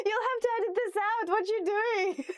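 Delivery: breaking into high laughter mid-sentence; giggling, can't hold it together; laughing. Real, not acted. To the person filming, laughing that this must be cut.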